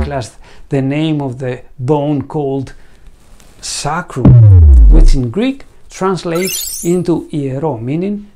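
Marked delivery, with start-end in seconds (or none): none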